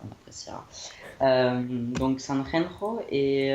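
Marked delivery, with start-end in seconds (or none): 0.1-0.7 breathy whispered repeat, under the breath; on appréciera whispered, no voice; whispered aside. Shown as a demonstration. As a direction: mutter it again in a whisper. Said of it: "repeated whisper".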